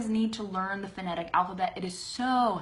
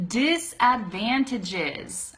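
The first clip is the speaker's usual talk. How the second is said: slow, syllable by syllable; teacher's drawn-out model pronunciation; slow demonstration; 2 semitones higher, much slower than usual. Shown as a demonstration. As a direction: say it slowly for a learner. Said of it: "That's saying it slowly".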